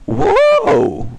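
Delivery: high, loud cry of surprise; startled whoa. Real, not acted. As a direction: burst out amazed, up high. Startled by discovery in game.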